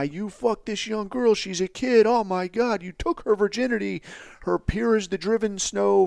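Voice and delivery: high, mock scandalised accusers; hammed-up outrage. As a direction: overplay the horror.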